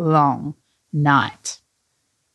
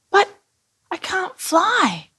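high, slow little-character disappointment; sad; 13 semitones higher, slower than usual. A narrator's reading. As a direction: draw it out sadly in a small high voice. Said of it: "said Billy sadly".

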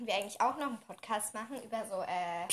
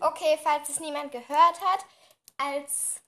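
louder; 4 semitones higher, 6 dB louder than usual. Real, not acted. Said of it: "Aber du musst lauter reden".

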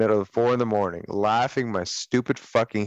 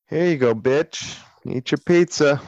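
mock grudging service; 6 semitones higher than usual. Acting out handing over pizza.

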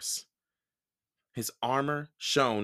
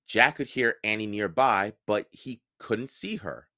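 usual speaking voice

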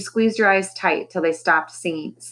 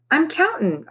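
proud; 9 semitones higher than usual. A narrator's reading. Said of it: "so proud of himself".

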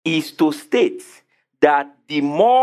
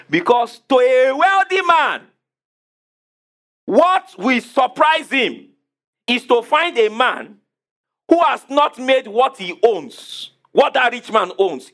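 preaching emphasis; 8 semitones higher, 3 dB louder, slower than usual. Acted.

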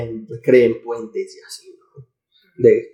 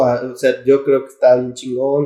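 normal talking voice

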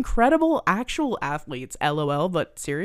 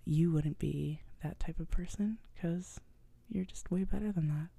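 softly whisper, teasing the listener; mock whisper; 11 dB quieter than usual. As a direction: keep it a whispered secret. Joins the whispered aside.

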